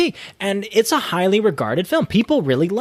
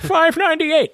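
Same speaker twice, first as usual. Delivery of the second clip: high, drawn-out order call; 10 semitones higher, slower than usual. Retold or imitated. Counter worker announcing chicken tenders order, calling out. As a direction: sing out the number across the room. Order caller shouting out numbers.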